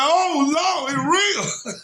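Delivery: high, drawn-out exclamation; retold alarm. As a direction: cry out as the ground vanishes. Retells losing footing in the water.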